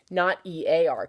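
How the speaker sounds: normal talking voice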